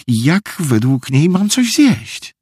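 high, slow, drawn-out protest; indignant. A narrator's reading. Meg can't eat while mother investigates, indignant. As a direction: protest it with pitch climbing. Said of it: "obruszyła się Meg".

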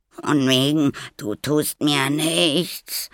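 high, loud, drawn-out whine; whining wail. A narrator's reading. Boy who bumped his head, whining complaint. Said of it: "jammerte er".

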